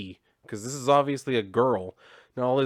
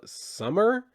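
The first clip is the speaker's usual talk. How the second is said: stunned recognition; 10 semitones higher than usual. Retold or imitated. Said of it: "he's just kinda like".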